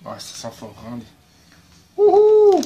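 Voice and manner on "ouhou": loud high "ouhou" cry; excited whoop. Real, not acted. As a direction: whoop at the smell. Reacting to strong smell. (cri aigu enthousiaste)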